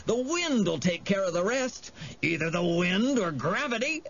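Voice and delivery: sly Jive. A narrator's reading. Jive reassures Harvey before the jump, lightly goading. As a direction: coax with a touch of mischief. Jive's character voice.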